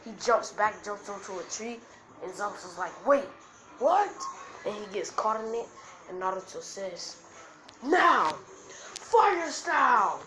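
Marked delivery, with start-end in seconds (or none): 3.1-4.1 high, loud shocked question; startled outcry. Acted. Acted villain caught off guard. 7.8-10.2 high, loud anime attack shout; battle cry. Acted. Acted anime attack call.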